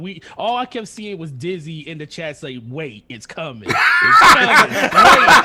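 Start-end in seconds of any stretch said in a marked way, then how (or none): 2.6-5.4 excited hype. Retold or imitated. Dizzy hyping up the chat before the reveal, loud laughing shout. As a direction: shout it like the big reveal is seconds away.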